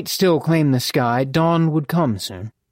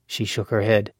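ordinary delivery, nothing marked

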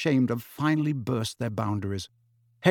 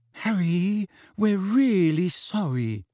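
slow, high desperate apology; 7 semitones higher, slower than usual. A narrator's reading. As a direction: plead on the edge of tears. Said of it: "said Hermione desperately".

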